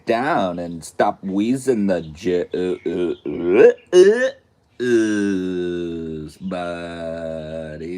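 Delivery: slow laid-back surfer drawl building to a loud whine; Pauly Shore impression. Pauly Shore telling Voldemort to chill, a slow drawl turning loud. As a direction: start lazy and slow, then blow up into a loud squeal. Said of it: "Pauly Shore would be like".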